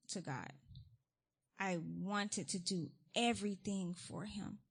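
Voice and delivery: ordinary talk, nothing marked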